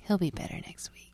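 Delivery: soft, unvoiced aside to the audience; stage whisper. Real, not acted. Cohost whispers to listeners about his grumpy partner. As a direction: whisper it behind his back, conspiratorially.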